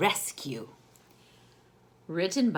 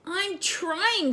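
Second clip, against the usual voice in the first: little sister voice; 12 semitones higher than usual. Acted. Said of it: "said Layla".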